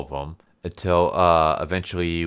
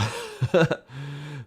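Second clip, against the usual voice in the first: laugh; 9 semitones higher than usual. Real, not acted. Laughing at weird story.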